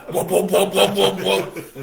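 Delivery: loud high laughing whoa, slowing; mock panic from too much coffee; panicked whoa. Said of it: "just like".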